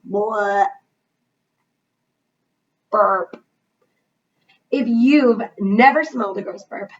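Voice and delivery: ordinary delivery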